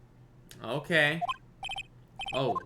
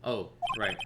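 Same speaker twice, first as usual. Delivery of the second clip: ordinary talk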